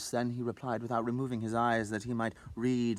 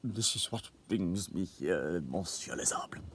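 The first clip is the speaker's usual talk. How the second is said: despondent tone; 4 semitones lower, 6 dB quieter than usual. A narrator's reading. Clerk confides his trouble to his colleague, despondent and hushed. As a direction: sigh it out like a man with a worry. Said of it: "finally said in a despondent tone".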